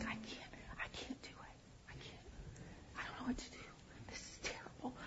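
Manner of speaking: urgent, unvoiced-breath whisper to one person; whispered aside. Wife confiding in her husband before dinner, whispered. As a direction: whisper it secretly, desperate not to be overheard.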